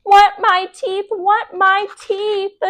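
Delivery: high, slow, dragged-out wail; a wailing little kid's demand; toddler wailing. A narrator's reading. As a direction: cry out for the lost teeth like a toddler. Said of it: "Fudge started to scream".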